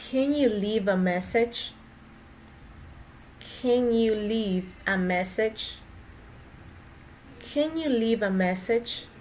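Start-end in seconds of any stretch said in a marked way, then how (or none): 3.4-5.8 slow question with a gentle rise; slow model question; slow repeat. Shown as a demonstration. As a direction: ask it slowly so learners can follow. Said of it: "teacher repeats sentence slowly for practice".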